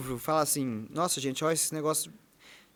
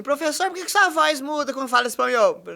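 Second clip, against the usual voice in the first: high, loud student asking; 9 semitones higher, 5 dB louder than usual. Retold or imitated. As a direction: voice the curious student. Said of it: "levantamos um aluno assim". (aluno curioso, voz aguda)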